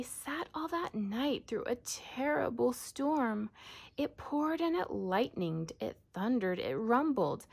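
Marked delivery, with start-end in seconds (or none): none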